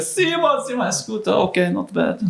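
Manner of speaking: high, half-impressed owner calling out; grudging restaurant owner. Retold or imitated. Owner grading the game in his restaurant, high and grudging. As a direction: call the names up high, then shrug it off.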